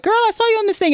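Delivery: high, teasing coworker voice; mock coworker. Coworkers teasing Haley about the viral video, high and playful.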